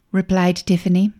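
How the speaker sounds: plain reading voice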